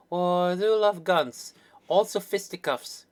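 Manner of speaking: high, drawn-out delight in a character voice; eager sidekick character. Acted. Acted character line after commentary.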